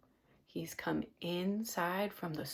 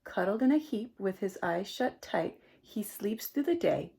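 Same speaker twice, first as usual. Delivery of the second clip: plain reading voice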